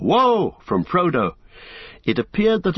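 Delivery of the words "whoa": high, loud startled shout. A narrator's reading. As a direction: yelp as the water hits. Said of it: "a shout of".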